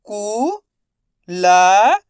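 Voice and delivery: extremely slow, sounds held long; slowly stretched. Shown as a demonstration. Teacher sounding out a word for children, very slow. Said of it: "teacher stretches word for pupils".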